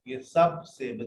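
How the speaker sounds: usual speaking voice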